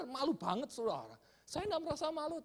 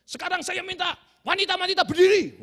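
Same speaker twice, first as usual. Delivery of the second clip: announcing pastor; 8 semitones higher, 11 dB louder than usual. Retold or imitated. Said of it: "dia berkata".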